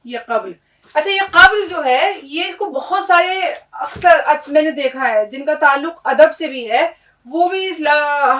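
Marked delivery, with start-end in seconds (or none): none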